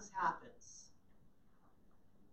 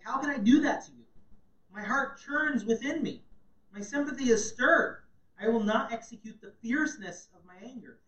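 fervent, higher and louder scripture; impassioned plea; 4 semitones higher, 9 dB louder than usual. Acted. Preacher reads God's pity for Ephraim, loud. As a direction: proclaim God's heart with fervour. Reading God's compassionate speech.

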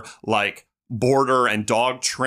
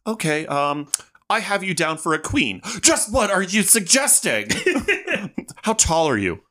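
higher, arch, mock-offended voices; campy hotel banter; 8 semitones higher than usual. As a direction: play both sides of the check-in with camp flair. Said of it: "It's like".

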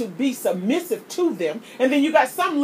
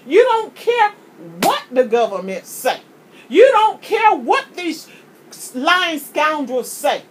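preaching at full force. Real, not acted. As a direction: preach it out, drive each line home. Preaching climax, rising fervor.

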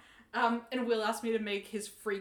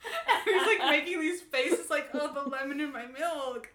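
mock whining husband; 6 semitones higher, 8 dB louder, slower than usual. Mocking husband's faces.

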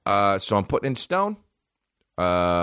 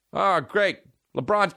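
higher, sarcastic grumbling dad; grumpy dad; 10 semitones higher, slower than usual. Retold or imitated. Dad overheard from the other room, grumbling sarcastically. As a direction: groan it like a fed-up father. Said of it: "what a dad would say".

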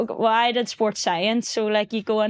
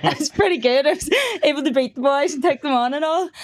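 laughing; 6 semitones higher, faster than usual. Real, not acted. Laughing about herself.

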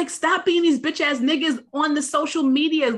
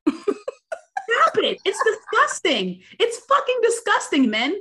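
exasperated rant; 5 semitones higher, slower than usual. Real, not acted. Ranting at men.

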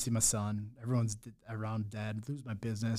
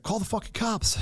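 much higher, barked order from dad; dad's blunt order; 10 semitones higher than usual. Retold or imitated. Dad on the phone telling son to call police, sharp order. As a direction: snap it like a father who wants no discussion. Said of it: "He's like".

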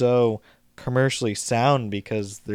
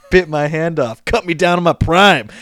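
raised, louder quoted complaint; mock indignant outburst; 5 semitones higher, 7 dB louder than usual. Retelling how the alligator took his hand, mock indignant and loud. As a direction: blurt it out as an acted gripe. Acting out Chubbs's angry retort.

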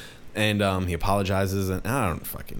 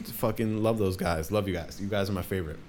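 usual speaking voice

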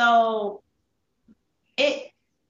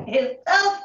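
fighting tears; 5 semitones higher, 4 dB louder than usual. Real, not acted. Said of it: "I'm trying not to cry".

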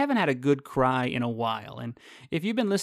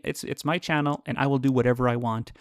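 normal talking voice

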